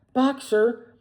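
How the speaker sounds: high, loud calling out; desperate cry. A narrator's reading. Clover crying after the departing van, desperate. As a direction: call after him in despair. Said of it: "she cried".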